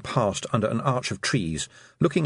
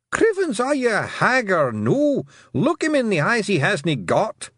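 high, exclaiming Scots voice; tiny Scotsman, worked up and shrieky; exasperated blue man; 11 semitones higher, 4 dB louder than usual. A narrator's reading. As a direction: scold her in a high, broad Scots yell. Exasperated blue man yells at her.

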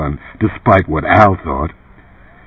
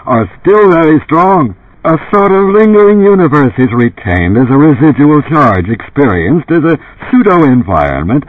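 higher, emphatic commercial spiel; TV pitchman; 5 semitones higher than usual. Acted. Runsider explaining Ubik to half-lifers, TV pitchman voice. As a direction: sell it earnestly like an infomercial host.

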